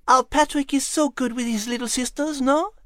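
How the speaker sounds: a fond lady asking for agreement; fond lady Liz. Acted. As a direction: Liz praising Patrick to Nigel. Liz speaks fondly of Patrick.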